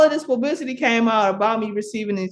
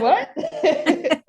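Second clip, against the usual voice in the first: high, laughing disbelief; stunned what; 10 semitones higher than usual. Retold or imitated. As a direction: gasp out the word in shock. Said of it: "I was like".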